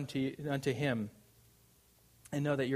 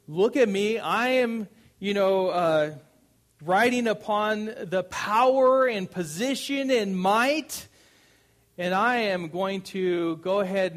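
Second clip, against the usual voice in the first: loud, high, puffed-up boast; bragging; 5 semitones higher, 7 dB louder than usual. Acted. Preacher acts out the unwilling Israelites, bragging loudly. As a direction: proclaim your own might grandly, easing off at the end. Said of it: "it's almost like bragging".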